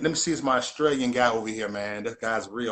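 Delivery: usual speaking voice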